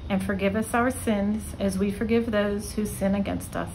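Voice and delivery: ordinary delivery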